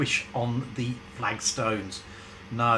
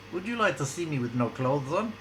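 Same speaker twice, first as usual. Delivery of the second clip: lightly voiced sultry Russian woman; a hint of a Russian temptress; bad Russian accent; 3 semitones higher than usual. Ivana flirting with Benny, mild Russian accent. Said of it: "in a bad Russian accent".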